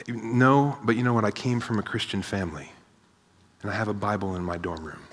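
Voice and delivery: quiet, trailing-off mock justification; hushed excuse. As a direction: offer a weak excuse under your breath. Voicing a churchgoer's weak excuse.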